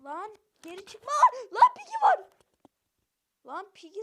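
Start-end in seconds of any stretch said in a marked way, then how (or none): 1.5-2.3 high loud startled cry; startled yell. Real, not acted. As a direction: yelp in alarm. Alarm at enemy.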